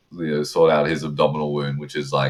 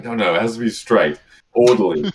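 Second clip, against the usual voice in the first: higher, louder fussy correction; mock officious, pitched up; fussy insistence; 5 semitones higher, 4 dB louder than usual. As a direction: insist on it like a fussy amateur surgeon. Voicing fussy amateur surgeon.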